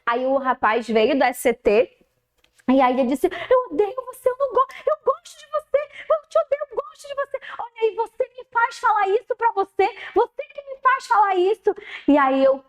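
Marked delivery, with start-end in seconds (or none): 3.3-11.8 high-pitched, flip-flopping plea; conflicted child. Retold or imitated. Student telling the teacher he hates and likes her, high pleading voice. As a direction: voice the boy swinging between hate and love, pitched up. Student torn between hate and affection.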